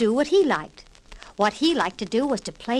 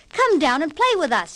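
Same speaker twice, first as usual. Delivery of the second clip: higher, louder playful call across the yard; 6 semitones higher, 4 dB louder than usual. Acted. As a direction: call him over to play. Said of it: "he called".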